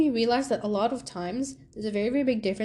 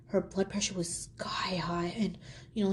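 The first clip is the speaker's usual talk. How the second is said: hushed telling, unvoiced; whisper; 6 dB quieter than usual. Real, not acted. Describing a sick lady in the hospital, hushed whisper. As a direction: drop to a whisper about her.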